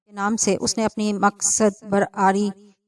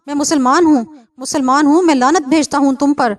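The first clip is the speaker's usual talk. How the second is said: high, loud defiant shout; 4 semitones higher, 5 dB louder than usual. Acted. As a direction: yell it back at them, finally admitting it. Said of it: "چیخ کر کہا".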